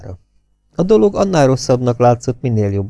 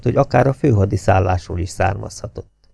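ordinary delivery, nothing marked

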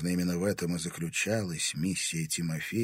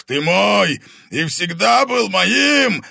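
high, loud, drawn-out roar; growling roar; 11 semitones higher, 12 dB louder, slower than usual. A narrator's reading. Convulsing dragon claiming Timofey as his, roaring. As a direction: roar your claim on him. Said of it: "прорычал он, корчась в судорогах".

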